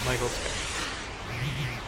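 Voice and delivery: slow drawl. Real, not acted. Joking correction, drawn out.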